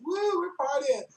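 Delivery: hammed-up drunk girls whooping; imitating drunk girls partying.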